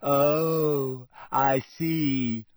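put-on drawn-out, mildly sulky ghost girl; morose. Snubbed ghost answers Harry, morose. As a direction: let it sag, hurt and sulking. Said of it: "in a morose sort of way".

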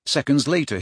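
plain reading voice

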